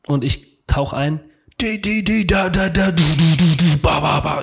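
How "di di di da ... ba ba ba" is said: imitated trip pulse. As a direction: mimic an overwhelming descending machine pulse. Imitates the trip taking over.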